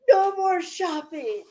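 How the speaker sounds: high, put-on ban; mimicked scolding. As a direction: hand down a mock order. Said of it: "That's what he said".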